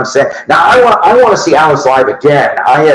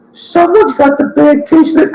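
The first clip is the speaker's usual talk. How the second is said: quoting the movie line much higher; mock movie-character voice, pitched way up; Office Space quote; 13 semitones higher than usual. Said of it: "as they say in Office Space".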